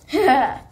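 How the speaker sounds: short loud villain chuckle; evil laugh. Acted. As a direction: laugh like a bully proud of his mischief.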